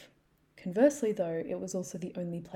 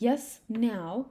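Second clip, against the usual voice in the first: lightly posh mum, louder and higher; 4 semitones higher, 6 dB louder than usual. Retold or imitated. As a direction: imitate an insistent mum in a posh accent. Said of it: "her voice just got all posh".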